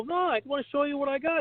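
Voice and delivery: high-pitched, insistent sales patter; acted-out pushy reassurance; retold eager salesman. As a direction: pitch it up and brush off doubts. Acting out salon cold-call pitch.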